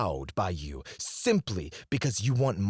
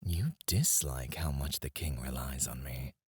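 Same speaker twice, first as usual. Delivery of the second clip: low, breathy whispered taunt; 9 semitones lower, 7 dB quieter than usual. Acted. Apothecary whispering his accusation to the commander, low and knowing.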